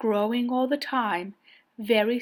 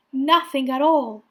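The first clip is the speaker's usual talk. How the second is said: ordinary delivery, nothing marked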